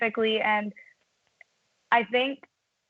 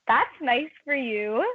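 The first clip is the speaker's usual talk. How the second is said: sarcastic retort; 4 semitones higher, slower than usual. Retold or imitated. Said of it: "And I was like".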